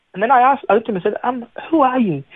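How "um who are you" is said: bewildered question. Retold or imitated. Asks the stranger who he is.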